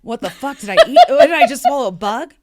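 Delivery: laughing. Real, not acted. Reacting to swallowing a bug.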